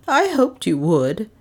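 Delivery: slow, with a mild nervous tremble; a touch of an old lady's nerves; nervous old lady, faintly trembling, slow; little tremble. A narrator's reading. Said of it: "a little tremble in her voice".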